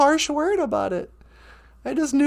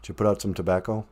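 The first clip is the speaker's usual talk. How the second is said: much lower put-on voice; deep co-host voice; 6 dB quieter, faster than usual. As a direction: ask like a deep-voiced sidekick.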